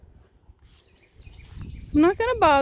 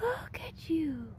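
softer, slower, voice catching with emotion; teary whisper; 2 semitones higher, 8 dB quieter than usual. Real, not acted. Moved as the baby stands.

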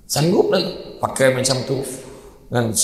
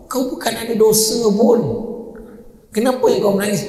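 wife asking puzzled; 7 semitones higher than usual. Retold or imitated.

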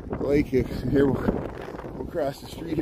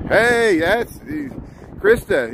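excited greeting; 9 semitones higher, much slower than usual. Real, not acted. Greeting a viewer by name.

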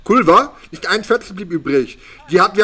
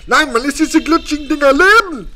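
much higher, aghast reveal; shocked; 10 semitones higher, 3 dB louder than usual. Real, not acted. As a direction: blurt it out in disbelief. Shocked reveal about live food.

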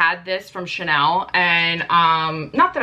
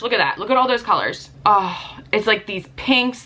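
ordinary delivery

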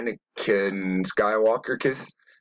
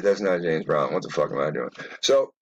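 ordinary talk, nothing marked

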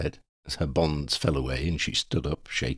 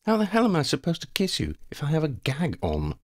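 raised, lightly voiced heroine; Hermione, mock indignant; 11 semitones higher than usual. Hermione asks Ron how to kiss while gagged, mock indignant. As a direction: pitch it up in playful protest.

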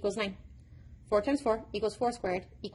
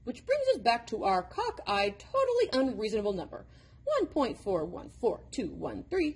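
ordinary delivery, nothing marked

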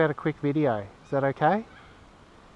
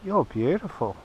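higher, friendly chat to a bird; pet talk; 7 semitones higher, 4 dB quieter than usual. Real, not acted. Man says g'day and chats to the kookaburra, higher pet-talk voice.

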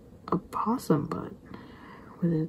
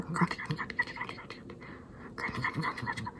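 hushed, whispery rapid crunch sounds; imitated crunching; 2 semitones lower, 9 dB quieter than usual. Imitating the possum at the cat food, hushed crunching. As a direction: rattle off soft munching noises under your breath.

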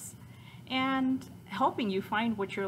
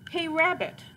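higher-pitched yell through a door; calling out; 11 semitones higher, 6 dB louder than usual. Acted. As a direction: call out to a neighbour. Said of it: "someone yelled".

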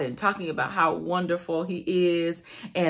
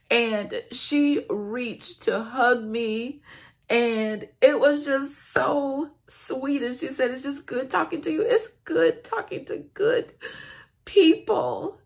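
crying; 6 semitones higher than usual. Real, not acted. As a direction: let the tears overtake the words. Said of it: "And I'm crying just like I am right now".

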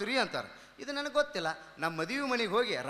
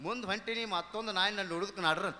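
normal talking voice

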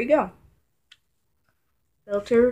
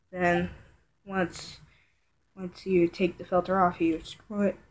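ordinary talk

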